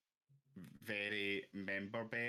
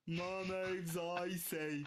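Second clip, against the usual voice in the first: slow, high London voice; London accent; 9 semitones higher, much slower than usual. Retold or imitated. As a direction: drawl it in London slang. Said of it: "the London version".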